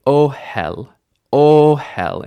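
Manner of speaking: Ohel with a loud, drawn-out first syllable; initial stress. Shown as a demonstration. Teacher modelling for learners, initial stress on Ohel. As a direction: lean hard on the O of Ohel. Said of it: "initial stress".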